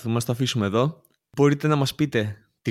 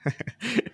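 a sudden high, breathy laugh; high burst of laughter; 10 semitones higher, 7 dB quieter than usual. Real, not acted. Cohost laughs at the Cannavaro picture, high short laugh. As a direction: crack up at the image.

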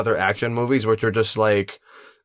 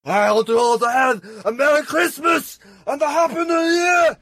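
Schwarzenegger voice; 11 semitones higher than usual. Pretend. Wishing listeners a Merry Christmas as Arnold, shouting. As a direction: belt the greeting in a thick Austrian accent. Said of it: "he said it in a really sad voice".

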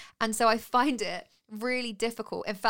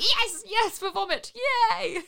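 high, loud celebrating; excited; 12 semitones higher, 4 dB louder, much slower than usual. Retold or imitated. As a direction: cheer like you've won something. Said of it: "I was like".

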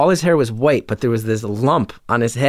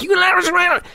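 mimicked yelling; slower than usual. Big truck driver yelling at the narrator, garbled high shout. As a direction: yell it like a furious local you can barely understand. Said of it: "marble mouthed".

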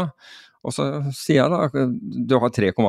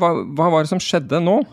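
high-pitched retold bafflement; acted puzzled questioning; baffled question; 7 semitones higher than usual. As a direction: ask it as if thrown. Baffled exchange with student, retold.

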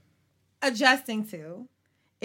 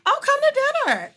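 imitating grandma; 7 semitones higher, 4 dB louder, faster than usual. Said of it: "I was like".

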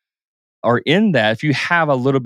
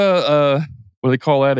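normal talking voice

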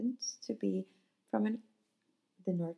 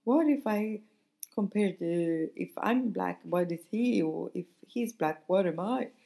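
mocking; 4 dB louder than usual. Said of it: "they were making fun of this".

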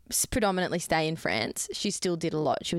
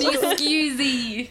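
slow, high put-on French "Excusez"; mock French apology; 7 semitones higher, 5 dB louder, much slower than usual. Apologising to French listeners, a hammed-up French accent. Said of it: "That's not even French".

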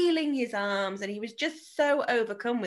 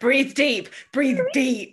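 mock breathing coach; 4 semitones higher, 7 dB louder, slower than usual. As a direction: cheer herself on through laughter. Mock coaching herself through chaos.

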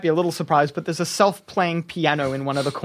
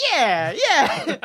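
vacant gnome agreement; 6 semitones higher than usual. Acted. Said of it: "these gnomes are like".

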